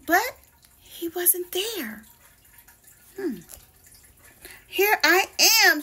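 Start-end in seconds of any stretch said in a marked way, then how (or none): 4.7-5.8 higher, louder shout; boy jumping out from hiding, a high, loud shout. Acted. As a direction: pop out and shout it. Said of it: "shouted Peter".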